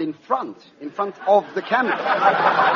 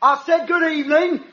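higher, cheeky Cockney stand-up voice; cockney comic; 6 semitones higher, 4 dB louder, faster than usual. Acted. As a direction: work the crowd as a Cockney club comic. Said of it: "that crazy cockney, top comic".

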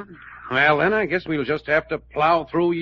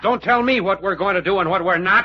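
loud, raised-pitch retort; yelling; 5 semitones higher, 5 dB louder than usual. Acted. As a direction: bark back in a quarrel. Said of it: "don't you dare yell at me".

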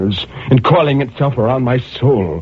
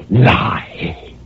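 hissing. Acted. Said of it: "that repulsive, hissing-like sound".

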